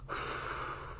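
soft breathy whoosh of a sigh; airy storybook wind sigh; windy sigh. Acted. Wind's sigh after the line.

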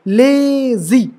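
ले in lazy held very long; lazy with a long, stressed first syllable. Shown as a demonstration. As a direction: drag out the ले of lazy, then drop on जी. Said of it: "ले जो जाएगा लंबा ही जाएगा".

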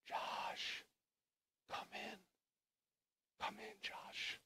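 slow, breath-only whisper; whispered call. Acted. God invites waiting Joshua inside, hushed whisper. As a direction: whisper an invitation with long pauses.

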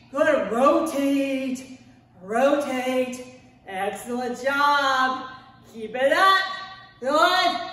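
low, loud coach encouragement; workout coach cues. Acted. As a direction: push them through the reps. Coaching during rotations.

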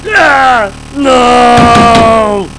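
high, loud wails of despair; anguished screams. Real, not acted. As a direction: scream at the crashed computer. Computer crashes, blue screen.

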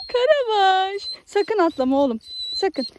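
loud, high excited calling; calling the dog. Real, not acted. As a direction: call out to a jumpy dog.

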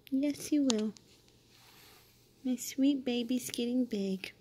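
ordinary delivery

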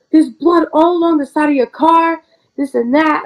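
high, alarmed mom's voice; mother telling her child about the bloody car, alarmed. Retold or imitated. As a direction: play the mother in a fluster.

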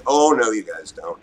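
ordinary talk, nothing marked

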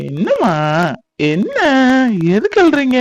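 put-on high, coaxing baby talk; cooing to baby. Uncle asking the crying baby what is wrong, high cooing.

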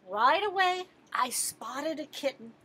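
plain reading voice

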